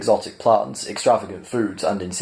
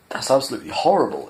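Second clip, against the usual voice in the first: higher, appalled outburst; exclaimed; 4 semitones higher, faster than usual. Acted. Arthur recoiling from the talking main dish, appalled exclamation. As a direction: let the disgust burst out. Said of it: "exclaimed Arthur".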